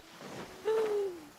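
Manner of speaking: high gasp of delight. Real, not acted. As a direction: gasp in sudden joy. Spots favorite character.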